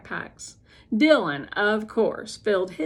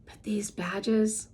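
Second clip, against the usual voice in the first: slow whisper; slower than usual. A narrator's reading. Quiet pride while others sleep.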